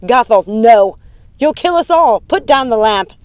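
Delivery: loud, high alarmed command; urgent yelling; panicked shout. A narrator's reading. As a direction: shout to stop her before disaster. Mother panics at threatened fire.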